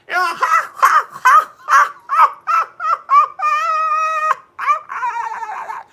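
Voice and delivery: very high, loud cackling laugh; happy chicken laugh. Acted. Host imitating a happy chicken, high squawking laugh. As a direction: squawk out a long, trailing laugh. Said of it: "What a happy chicken this is".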